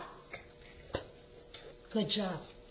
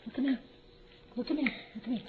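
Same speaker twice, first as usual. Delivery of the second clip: ordinary talk, nothing marked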